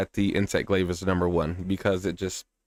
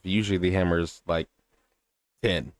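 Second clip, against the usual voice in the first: usual speaking voice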